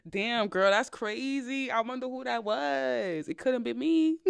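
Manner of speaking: slow, mock-innocent and amused; laughing, pretending it wasn't her; playing innocent. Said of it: "that's when I was like".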